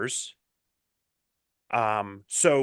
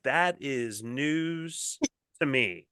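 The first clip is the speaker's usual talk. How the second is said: slow deadpan retort; much slower than usual. Retold or imitated. Dry retort to partner.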